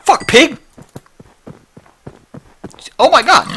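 loud startled yell at a pig; startled shout. Real, not acted. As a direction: shout in sudden surprise.

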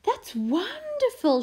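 high, admiring praise with a swoop; delighted mum. A narrator's reading. Mum praising her child's soggy picture, delighted. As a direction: gush over a child's picture. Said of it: "Mum held up the soggy picture".

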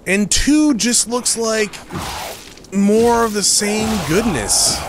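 high, slow and eager; excited gushing. Real, not acted. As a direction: savor the words with delight. Gushing about sequel.

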